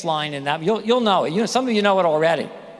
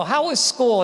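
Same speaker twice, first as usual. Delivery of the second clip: higher, louder eager question; eager parent; 7 semitones higher, 4 dB louder than usual. Retold or imitated. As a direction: ask brightly, eager to hear. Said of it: "You'd go".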